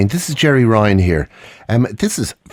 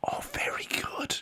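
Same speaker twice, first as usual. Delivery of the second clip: whispering; 18 dB quieter than usual. Real, not acted. Hushed praise.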